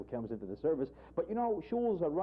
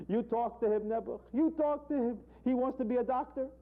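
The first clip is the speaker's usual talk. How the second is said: kvetching owner; 11 semitones higher than usual. Acted. Dog owner's punchline.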